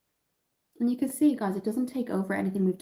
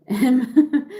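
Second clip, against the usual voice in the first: laughing; 5 semitones higher, 11 dB louder than usual. Real, not acted. Laughs while reading comments.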